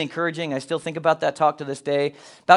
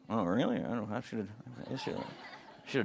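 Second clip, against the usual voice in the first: hesitant quiet father; 9 semitones lower, 16 dB quieter than usual. Retold or imitated.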